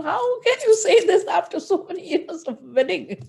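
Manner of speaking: high, pleading wife breaking down; heartbroken wife. Acted. Wife reproaching the Lord after years of marriage, high and pleading.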